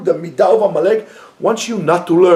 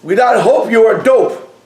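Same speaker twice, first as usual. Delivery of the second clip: higher, louder, laughing punchline; 5 semitones higher, 3 dB louder than usual. Shown as a demonstration. Rebbe rewording the line as a joke, laughing, higher and louder. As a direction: deliver the quip with a laugh. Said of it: "How should I say it?".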